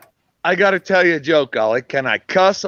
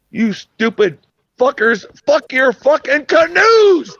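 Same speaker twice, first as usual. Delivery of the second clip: higher, louder, slow furious yell; furious punchline shout; 10 semitones higher, 4 dB louder, slower than usual. Retold or imitated. Telling a joke to friends, the punchline yelled. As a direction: bellow the curse, drawing it out.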